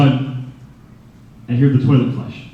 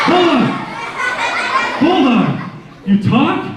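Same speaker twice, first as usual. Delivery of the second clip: shocked; 10 semitones higher than usual. Retold or imitated. Kevin hears his cat talk, high shocked cry. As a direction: yelp it in disbelief.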